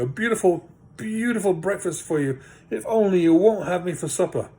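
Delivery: high, begging hobbit voice; pleading hobbit. Acted. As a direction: plead with the trolls for your life. Bilbo begs the trolls.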